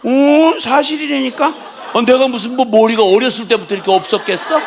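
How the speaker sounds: laughing as he insists he was cuter; laughing insistence. Real, not acted.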